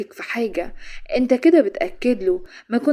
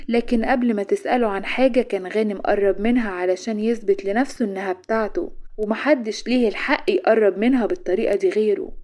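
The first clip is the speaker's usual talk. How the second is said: ordinary delivery, nothing marked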